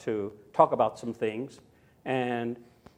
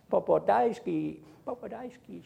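put-on sweet toddler voice; 3 semitones higher, 4 dB quieter than usual. Granddaughter's loving words.

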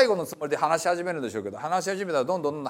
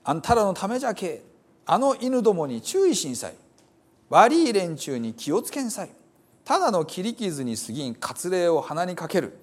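Hiroshima dialect reading; 2 semitones higher than usual. Acted. Preacher reading Paul's letter to the brothers in Hiroshima dialect. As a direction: read the scripture as a Hiroshima local would say it. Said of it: "あんまり使わなくなった広島弁ですが".

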